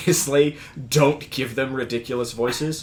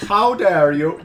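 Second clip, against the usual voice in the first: louder, indignant mock-Swedish accent; bad Swedish accent; 4 semitones higher, 5 dB louder, slower than usual. Player mimicking Bjorklund's line stuck in their head, bad Swedish accent. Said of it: "bad Swedish accent".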